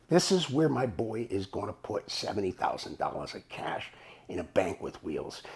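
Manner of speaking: very low, near-whispered aside; hushed disbelief. Real, not acted. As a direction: drop the voice right down, appalled. Dismayed aside after discovering trailer.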